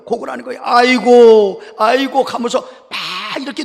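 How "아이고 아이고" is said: mock loud, drawn-out lament; imitated wailing. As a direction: wail as if mourning a death, in imitation. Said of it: "큰 소리로 막 우는 거예요". (곡하는 흉내)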